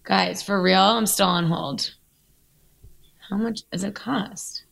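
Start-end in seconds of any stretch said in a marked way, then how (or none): 3.3-4.6 whispering. Real, not acted. On hold, impatient.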